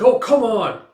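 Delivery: high, loud oh come on; exasperated shout. Retold or imitated. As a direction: groan it out in loud frustration. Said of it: "it's like".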